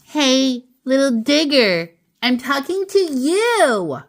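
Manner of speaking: mother calling her boy, lightly voiced; Mama calling. A narrator's reading. Mama scolds her kitten, mildly stern. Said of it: "said Mama".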